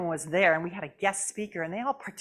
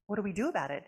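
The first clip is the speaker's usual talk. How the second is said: usual speaking voice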